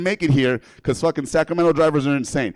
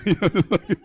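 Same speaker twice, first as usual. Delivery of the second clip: ordinary talk, nothing marked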